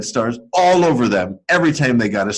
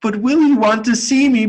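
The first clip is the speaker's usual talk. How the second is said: much higher pleading puppet voice; a small wooden puppet calling out, pitched high and worried; Punchinello crying out; 11 semitones higher, slower than usual. Acted. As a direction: call after her high and anxious. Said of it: "Punchinello cried out".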